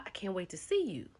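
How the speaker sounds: whispered lovey-dovey line; a whispered mock sweet nothing. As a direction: breathe it like a secret to a lover. Girlfriend sweet-talking the other man.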